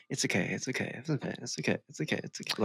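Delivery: fading from soft murmur to a breathy whisper; soothing whisper. Pretend. Cohost voicing the murderous snail, sinking into a whisper. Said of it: "He's like".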